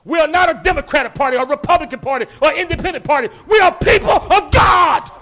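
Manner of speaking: loud, high, slowing shouted declarations; full-force preaching. Acted. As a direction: thunder it from the pulpit.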